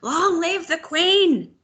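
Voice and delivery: high, slow swelling shout; the whole crowd roaring the chant together. A narrator's reading. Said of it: "all the people around her were shouting".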